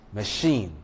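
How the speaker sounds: "machine" with a schwa, then strong CHINE; stress on second syllable. Shown as a demonstration. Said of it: "does not take stress".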